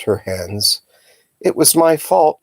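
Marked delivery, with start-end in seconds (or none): 1.4-2.4 distressed. A narrator's reading. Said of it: "she said".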